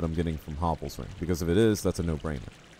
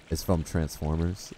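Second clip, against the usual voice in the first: normal talking voice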